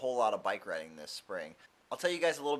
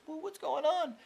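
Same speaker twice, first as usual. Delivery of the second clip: high, put-on "whoa" while peeking; nosy surprise. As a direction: play the curious neighbour.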